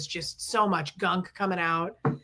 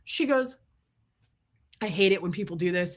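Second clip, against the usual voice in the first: normal talking voice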